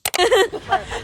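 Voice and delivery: big burst of laughter; loud laugh. Real, not acted.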